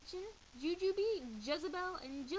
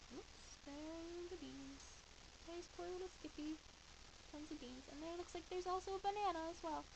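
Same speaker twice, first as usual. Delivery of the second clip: softer, chatty commentary; soft aside; 5 semitones higher, 7 dB quieter than usual. Real, not acted. Reader showing the pictures to a child, softly. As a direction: talk over the picture. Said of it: "showing the picture".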